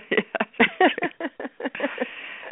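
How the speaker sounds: breathy high-pitched giggle; airy squeal of laughter. Real, not acted. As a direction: let a delighted laugh escape. Delighted laugh at compliment.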